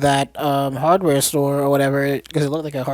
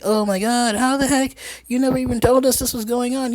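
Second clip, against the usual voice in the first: high-pitched imitated outrage; mock confrontation; 8 semitones higher than usual. Niece confronting her aunt over the secret, mock outraged. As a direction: accuse in a hammed-up higher voice. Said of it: "she's confronta-, she's like".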